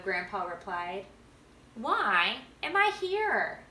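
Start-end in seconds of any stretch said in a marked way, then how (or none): 1.8-3.6 put-on a boy's higher, slow, wondering question; curious Max. A narrator's reading. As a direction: ask it like a kid puzzling over life. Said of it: "Max inquired".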